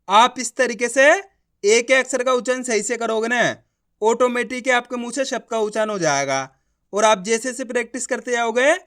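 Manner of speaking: ordinary talk, nothing marked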